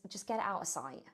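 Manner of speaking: ordinary talk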